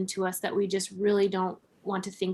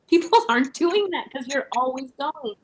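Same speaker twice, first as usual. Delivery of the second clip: laughing through the line, louder and higher; 5 semitones higher, 5 dB louder than usual. Real, not acted. Host laughing to guest about busy lives, laughing. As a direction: chuckle it out as you say it.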